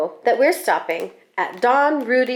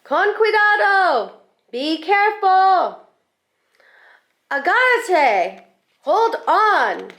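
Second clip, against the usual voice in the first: calling out; 9 semitones higher than usual. Acted. Papi calling to his daughter on the motorcycle, high and urgent. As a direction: call out over the engine to hold on.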